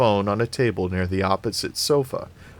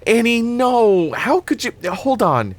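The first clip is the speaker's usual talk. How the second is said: dismayed protest; 13 semitones higher, 5 dB louder than usual. A narrator's reading.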